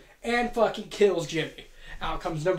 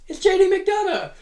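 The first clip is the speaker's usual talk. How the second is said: mock announcer; 12 semitones higher, 6 dB louder than usual. Announcing a wrestler's entrance.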